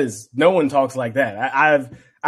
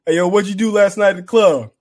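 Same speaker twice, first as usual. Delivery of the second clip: lightly voiced hyped-up teammate, louder and higher; Spice Adams bit; 6 semitones higher, 3 dB louder than usual. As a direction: call across the locker room like a hyped-up teammate. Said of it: "he does it when they're".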